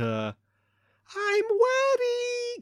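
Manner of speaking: put-on very high, drawn-out cutesy child voice; adult putting on a lisping little girl; baby voice. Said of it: "I hate that I just did that".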